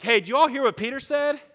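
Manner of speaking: high, loud call to the group. Acted. Jesus turning to the disciples to expose Peter, calling out. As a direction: call the whole crowd's attention. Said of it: "And Jesus is like".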